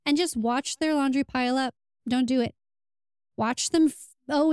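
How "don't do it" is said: usual speaking voice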